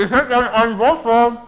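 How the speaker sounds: muffled with a full mouth. Real, not acted. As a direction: talk through a mouthful. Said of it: "Was hättest du gern?".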